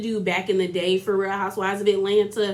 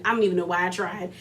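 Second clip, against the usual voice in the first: ordinary delivery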